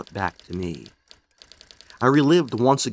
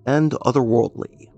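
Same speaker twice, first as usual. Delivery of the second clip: announcer's sign-off voice; warm, inviting close of the episode; show outro; 3 semitones higher, 4 dB louder than usual. Acted. Host signs off to listeners, announcer outro.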